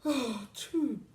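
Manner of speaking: hammed-up hippo. A narrator's reading. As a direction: drag it out like a heavy hippo. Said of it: "sighed the hippo".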